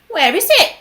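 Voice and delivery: loud, high call from the crowd; shouted. A narrator's reading. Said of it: "somebody shouted".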